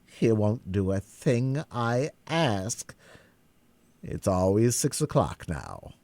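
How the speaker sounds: usual speaking voice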